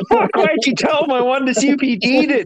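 high, indignant protest; complaining the fun was spoiled; exasperated outburst. Retold or imitated. Complaining to Johnny who ruined the joke, indignant and high. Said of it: "I was like".

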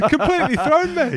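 high, loud, amused protest; laughing. Real, not acted. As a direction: say it through helpless laughter. Laughing after mimicking co-host.

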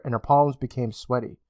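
plain reading voice

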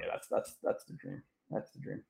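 unvoiced dreamy whisper; hushed wistful wish; whispered longing. Real, not acted. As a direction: breathe it like a dream. Dreamy wish about Zach Martin.

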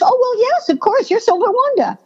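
high, matter-of-course reply, lightly acted; voz aguda de produtor. Casting people reassuring her, acted.

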